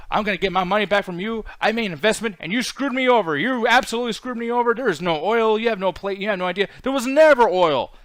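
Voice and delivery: indignant investor. Retold or imitated. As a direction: confront him as a cheated investor, rising to a loud finish. Acted angry investor confronting Max.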